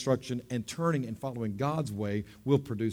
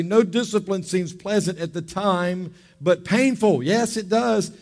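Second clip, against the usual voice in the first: raised, emphatic preaching read; scripture reading; 4 semitones higher, 5 dB louder than usual. Acted. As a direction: proclaim the verses with fervor.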